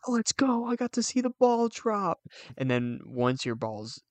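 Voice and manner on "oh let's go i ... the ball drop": put-on high, eager little kid; excited kid voice. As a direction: squeal it like a kid at New Year's. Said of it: "you'd be like".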